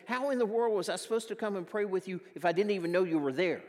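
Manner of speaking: loud, fast rebuke; mild exasperated scolding. Retold or imitated. Pastor scolding the upset church member, loud and fast.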